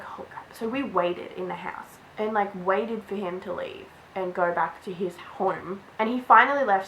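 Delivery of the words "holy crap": hushed, retold oh-no; whispered dismay. Girl spotting the boy waiting outside, whispered dismay. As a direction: drop to a whisper, acting out dismay.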